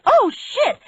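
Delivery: very high, loud scream of alarm. A narrator's reading. Man about to dive off a boat before an explosion, screamed. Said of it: "Barry West screamed".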